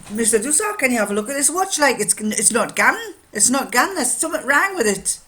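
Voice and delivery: quick, high, loud Geordie; Geordie accent. Retold or imitated. Miner reporting a watch not gone, Geordie accent. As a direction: rattle it off as a Geordie customer. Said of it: "he'd say it in Geordie".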